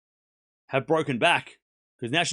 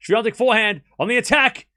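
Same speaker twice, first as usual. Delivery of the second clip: higher and louder with every shot; excited play-by-play building to a shout; 6 semitones higher, 7 dB louder than usual. Acted. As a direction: call the winner like a commentator going wild.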